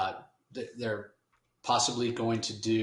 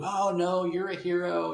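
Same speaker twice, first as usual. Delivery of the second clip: a light, higher granny voice, mildly voiced; doting grandmother; 9 semitones higher than usual. Grandmother comforting her grandson, a lightly voiced high granny tone. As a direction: gently play a sweet old grandma reassuring him. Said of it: "like every good grandmother will do".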